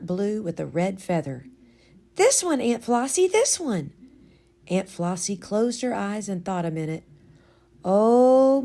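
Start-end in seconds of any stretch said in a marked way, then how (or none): 2.1-3.9 put-on higher, eager little girl; lightly voiced eager child. A narrator's reading. Child pleading for hat. 7.8-8.6 loud delighted oh; a delighted exclamation. A narrator's reading. Aunt exclaims remembering.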